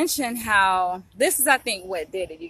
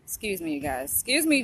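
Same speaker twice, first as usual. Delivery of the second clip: usual speaking voice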